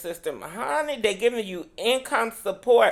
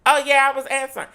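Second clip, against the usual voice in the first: flustered defensive; 7 semitones higher, 7 dB louder, much faster than usual. Real, not acted. Caught not answering, defensive.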